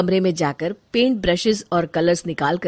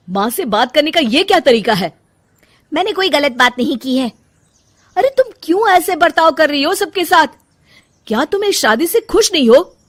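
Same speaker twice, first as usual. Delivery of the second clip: raised, reproachful questioning; louder, higher, exasperated husband; upset confrontation; 8 semitones higher, 7 dB louder than usual. Acted. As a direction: demand an explanation from your wife.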